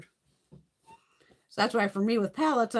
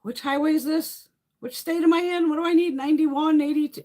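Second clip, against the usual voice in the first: lost and flustered; 10 semitones higher, 6 dB louder than usual. Retold or imitated. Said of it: "it would be like".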